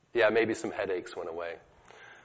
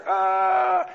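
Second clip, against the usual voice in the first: a huge high shout, imitating the healed man; hammed-up running scream; mock scream; 8 dB louder than usual. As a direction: belt out a joyful yell as you retell it. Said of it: "waving his crutches over his head and screaming".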